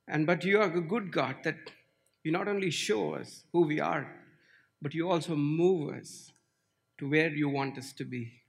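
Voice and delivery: usual speaking voice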